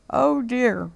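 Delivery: a small cub's dismayed little cry; baby bear's wee voice. A narrator's reading. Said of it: "cried baby bear in his wee little voice".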